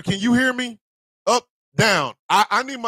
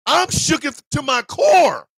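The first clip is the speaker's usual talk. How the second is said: mock dramatic; 6 semitones higher, slower than usual. Real, not acted.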